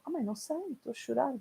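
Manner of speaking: put-on small lost voice of a weepy teenager; young self crying. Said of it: "E eu". (adolescente frágil, mais aguda e suave)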